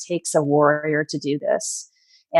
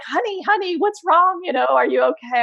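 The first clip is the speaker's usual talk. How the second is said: high, concerned mother on the phone; mom's high worried voice on the phone; 11 semitones higher than usual. Retold or imitated. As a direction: imitate a worried mother asking what's wrong. Mom answers phone, worried.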